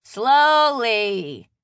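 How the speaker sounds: one loud, exasperated word; said just as loudly. A narrator's reading. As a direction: call it out for the culprit to hear. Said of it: "Maddie said just as loudly".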